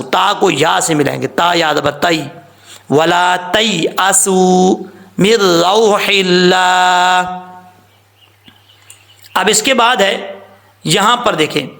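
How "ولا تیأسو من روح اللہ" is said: Quran recitation. Shown as a demonstration. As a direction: draw out each word like a recitation. Reciting the verse.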